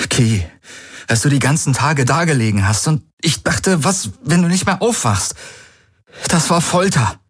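reliving the torture of waiting; anguished Galen. A narrator's reading. Galen tells Ki of his fear, anguished. Galen recalls fearing Ki's death.

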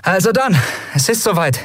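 rally them with a bright, quick voice; a young captain giving the order to go; captain Endriel. A narrator's reading. Endriel's voice, held.

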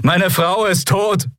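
high, drawn-out anguished cry; anguished accusation. A narrator's reading. Bearded man on his dead wife, anguished cry. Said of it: "sagte der bärtige Mensch".

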